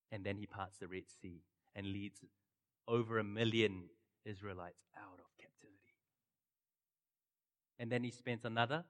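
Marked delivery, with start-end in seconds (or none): 4.2-5.9 whispered, voiceless; hushed whisper closing the exodus line. A narrator's reading. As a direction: breathe the words out. Telling the exodus.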